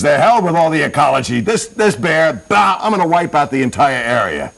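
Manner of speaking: high-pitched ranting threat; worked-up, hammed-up fury; angry vow. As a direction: rant like a hunter bent on revenge. Acted hunter vows revenge.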